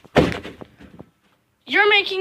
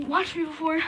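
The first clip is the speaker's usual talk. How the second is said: ordinary talk, nothing marked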